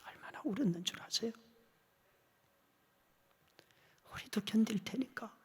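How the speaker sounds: hushed unvoiced whisper. Real, not acted. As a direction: drop to a whisper, moved. Recalling how he wept.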